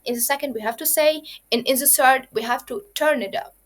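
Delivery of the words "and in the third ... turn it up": ordinary delivery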